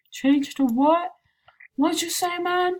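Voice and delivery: puzzled. Real, not acted. Reading chat then reacting.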